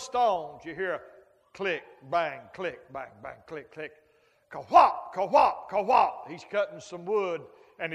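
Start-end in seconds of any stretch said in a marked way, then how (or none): 1.5-3.9 imitated stone clacks. Imitating stones being stacked. 4.5-6.3 imitated chopping thuds. Imitating wood being chopped.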